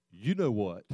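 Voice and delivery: low, soft devil's taunt. Acted. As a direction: drop down quietly like the accuser. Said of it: "that's what the enemy oftentimes does".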